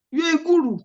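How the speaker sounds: high, excited hail of a guru; a teacher hamming up praise for a top student; mock awed praise. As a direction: cheer her on like a fan. Teacher hails student's first-attempt seven.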